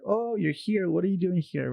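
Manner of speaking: high-pitched mock surprise; imitated surprise. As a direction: mimic someone taken aback by a newcomer. Mimics people surprised to see her.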